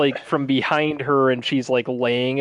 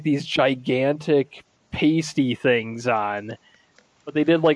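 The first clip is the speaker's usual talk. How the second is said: ordinary talk, nothing marked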